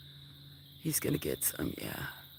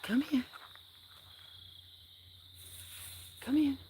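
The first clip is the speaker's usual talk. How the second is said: higher, bright repeated call; 10 semitones higher than usual. Real, not acted. Owner coaxes a cat to come, high calling voice. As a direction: coax the cat over from a distance.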